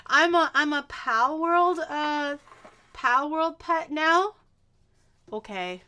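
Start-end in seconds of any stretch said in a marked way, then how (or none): 0.1-4.3 higher, louder, slow incredulous; can't believe what chat said; amused disbelief. Real, not acted. Reacting to chat calling her a pet, amused disbelief.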